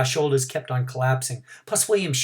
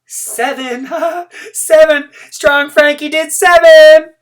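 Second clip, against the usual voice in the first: much higher and louder, slowed and stretched, with a laugh; shouted announcement; 14 semitones higher, 13 dB louder, slower than usual. A narrator's reading. Thelma bragging about Frankie's score, shouting. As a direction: crow the number to everyone. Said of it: "shouted New Thelma".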